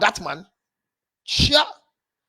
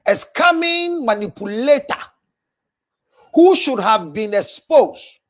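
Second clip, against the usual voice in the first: 1 slow loud denunciation; 3 dB louder, slower than usual. Real, not acted. Denouncing a fraud to the viewers.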